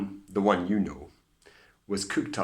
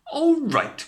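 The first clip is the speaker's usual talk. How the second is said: high, loud cry; cried; 9 dB louder than usual. A narrator's reading. Cindy cries back at the fairy. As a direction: cry it out in outrage. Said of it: "cried Cindy".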